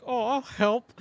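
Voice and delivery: mock helpful-child voice; lightly voiced little kid. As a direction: pipe up like a little kid helping. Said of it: "like a little kid".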